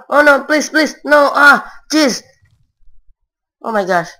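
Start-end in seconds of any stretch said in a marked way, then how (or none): 0.1-2.2 higher, louder begging in real fear; desperate pleading. Real, not acted. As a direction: beg the game to spare him. Trapped by zombies.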